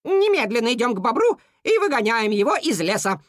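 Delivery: high, emphatic bossy cuckoo; peremptory declaration. A narrator's reading. Cuckoo rallying the animals against the beaver, peremptory. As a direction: declare it, allowing no argument. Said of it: "безапелляционно заявила Кука".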